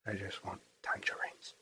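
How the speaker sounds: breathy hushed parody line; mock whisper. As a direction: whisper it conspiratorially.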